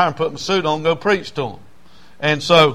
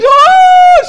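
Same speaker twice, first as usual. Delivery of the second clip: very high, loud woo shouted out; ecstatic woo; 9 dB louder than usual. Retold or imitated. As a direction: cry out like a woman overcome in church. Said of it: "She was crying".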